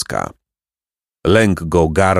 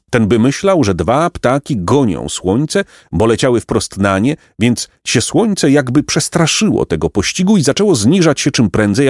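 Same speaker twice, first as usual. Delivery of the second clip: ordinary delivery, nothing marked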